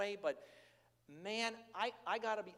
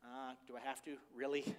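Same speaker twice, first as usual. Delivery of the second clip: put-on soft, lower pleading little-boy voice; a hint of a boy who won't give up the baby; reluctant child; 4 semitones lower, 5 dB quieter than usual. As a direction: whine reluctantly like a small boy. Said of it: "It's like".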